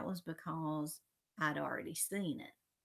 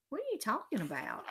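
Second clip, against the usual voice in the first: quick, higher-pitched protest; defensive denial; 6 semitones higher, faster than usual. A narrator's reading. Peter answers Alex's love question, defensive denial. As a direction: brush off the teasing fast.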